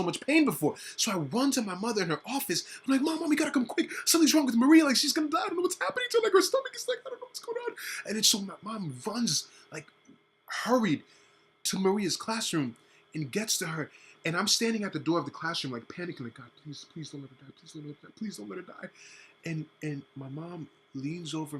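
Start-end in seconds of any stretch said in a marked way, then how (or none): 3.0-7.7 high, urgent rush to mom; pitched way up, begging for help; high frantic child calling mom; panicked plea. Retold or imitated. Kid runs to mom, panicking. 16.3-18.9 soft, hushed pleading prayer; softer hushed prayer; desperate prayer. Retold or imitated. Panicking child praying at door.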